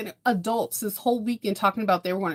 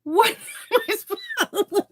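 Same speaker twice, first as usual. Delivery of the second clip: slow, high, laughing through it; laughing at her son's worry; laughing disbelief; 12 semitones higher, 3 dB louder than usual. Real, not acted. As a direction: crack up while asking.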